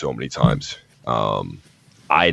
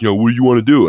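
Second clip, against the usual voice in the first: higher, louder retold challenge; mock hothead; 6 semitones higher, 7 dB louder, much faster than usual. Imitating the hothead challenging him to a fight, mock aggression. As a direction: square up like a hothead, mockingly. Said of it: "obviously he was a hothead".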